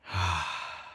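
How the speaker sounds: long, low breath out; relaxed meditative sigh; deep exhaling sigh. Shown as a demonstration. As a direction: let a full breath go with a sigh.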